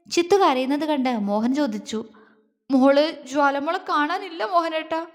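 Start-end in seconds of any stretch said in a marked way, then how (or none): 2.7-5.0 higher, tearful report to her husband. A narrator's reading. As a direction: voice the worry close to weeping. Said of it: "വീണ്ടും കരച്ചിലായി".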